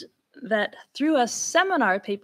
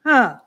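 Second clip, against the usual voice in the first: a loud, firm falling "Ah."; falling third, strong assertion; 3 semitones higher, 9 dB louder than usual. Shown as a demonstration. Lecturer demonstrating an old elocution rule: firm falling "Ah.". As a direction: drop the "Ah." decisively like a strong assertion. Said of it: "if it falls in the same degree".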